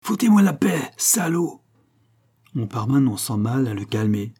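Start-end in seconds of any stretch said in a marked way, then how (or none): 0.0-1.6 furious shout. Acted. Father shoves Volpi off.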